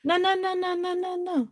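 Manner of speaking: rising panicked denial, high pitched. Real, not acted. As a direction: blurt it out in growing alarm. Player panicking at bad news.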